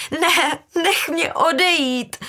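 high, loud, drawn-out cry; frantic daughter breaking in; desperate plea. A narrator's reading. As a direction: beg loudly to be let go. Daughter pleads to be released.

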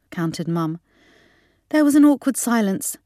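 ordinary delivery, nothing marked

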